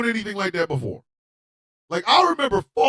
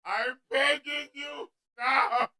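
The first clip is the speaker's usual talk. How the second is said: tiny, faint mock begging; six-year-old pleading; 2 semitones higher, 10 dB quieter, slower than usual. Six-year-old pulling back on a racing horse, faint helpless pleading. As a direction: beg feebly in a very soft child's voice. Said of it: "my six-year-old strength, like".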